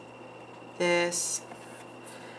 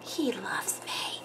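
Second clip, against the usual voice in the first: whisper; 12 dB quieter, much slower than usual. Real, not acted. Whispering beside the sleepy baby.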